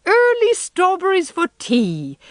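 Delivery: put-on light up like a girl at the news; delighted exclaim. A narrator's reading. Miss Lavendar hearing of early strawberries: high delighted exclaim. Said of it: "exclaimed Miss Lavendar".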